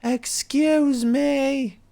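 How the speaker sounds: high, very slow drawn-out shriek; mock Vickie yell. Podcaster imitating Vickie Guerrero yelling at the crowd. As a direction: stretch out excuse me like a heel manager.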